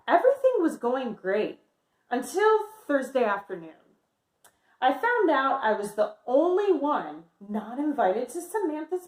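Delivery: lively picture-book read-aloud voice; reading to children. Acted. Teacher reading Amelia's story to her class, lively.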